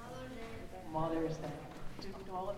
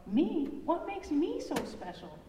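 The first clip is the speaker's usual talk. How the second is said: put-on high, bright kid voice; a puzzled little monster; surprised child; 9 semitones higher, 7 dB louder than usual. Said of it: "Max shrugged".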